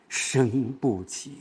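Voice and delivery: soft, very slow and drawn out; light reading. Shown as a demonstration. Teacher showing a key word that cannot rise, light reading. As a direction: let it sink softly, stretching each syllable. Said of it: "但是我轻读".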